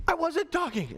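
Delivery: high-pitched mock student protest; indignant pupil talking back, hammed up; arguing student. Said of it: "it becomes an argument".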